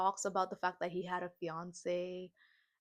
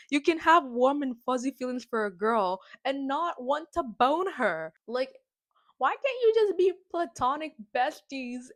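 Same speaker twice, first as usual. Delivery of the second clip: outraged rant; 6 semitones higher, 9 dB louder than usual. Retold or imitated. Outraged reaction to the kiss.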